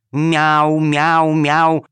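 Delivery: loud meowing three times over; meow, meow, meow at them; string of meows. Acted. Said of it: "повторила кошка-хромоножка".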